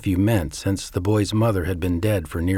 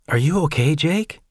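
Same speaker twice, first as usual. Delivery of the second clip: higher, gently concerned woman's voice; a light hint of Janet asking if he's okay; Janet's voice; 7 semitones higher than usual. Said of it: "she asked".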